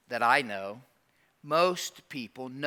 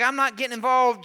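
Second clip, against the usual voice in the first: firm refusal; 7 semitones higher, 7 dB louder than usual. Retold or imitated. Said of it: "He's like".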